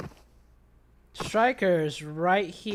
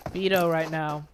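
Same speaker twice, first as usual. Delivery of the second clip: usual speaking voice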